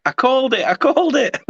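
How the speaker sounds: high, smug sing-song gloat. Retold or imitated. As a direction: crow it like you predicted it. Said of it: "messaged me and went".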